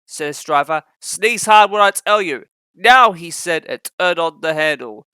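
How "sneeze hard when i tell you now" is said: louder, higher calling out; called command. A narrator's reading. Driver calling to Henry at the bridge, loud. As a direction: call the signal.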